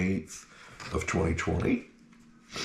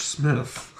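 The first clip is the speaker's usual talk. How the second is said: ordinary talk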